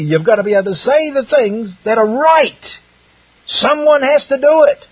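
rousing pulpit exhortation; impassioned preaching. Real, not acted. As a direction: press the challenge on the congregation, pitched high. Preaching at raised force.

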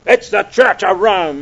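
a hint of zealous certainty; emphatic. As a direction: hammer it like an old preacher. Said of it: "it was taught to me very emphatically".

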